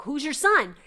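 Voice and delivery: loud, high urgent question; loud pleading who's your son; eager question. Retold or imitated. Eager question to dream man.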